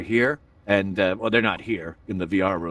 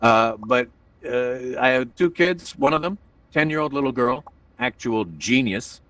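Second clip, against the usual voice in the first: normal talking voice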